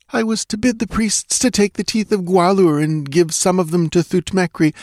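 high, anxious female character voice; frightened dancing girl. A narrator's reading. Captive girl telling Conan the secret way, anxious. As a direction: explain earnestly in a high girlish voice.

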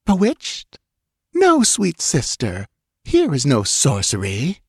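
high, sweetly poisonous apparition; honeyed venom. A narrator's reading. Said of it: "its voice was like honeyed venom".

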